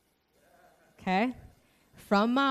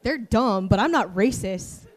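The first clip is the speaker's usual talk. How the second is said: laughing; 9 dB louder than usual. Real, not acted. Joking self-own, laughing.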